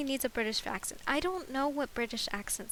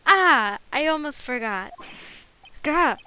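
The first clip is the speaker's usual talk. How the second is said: loud, higher bellboy outburst in attempted British; startled British bellboy; 4 semitones higher, 12 dB louder than usual. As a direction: burst out as a flustered British bellboy. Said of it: "give him a British accent".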